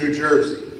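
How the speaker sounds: ordinary talk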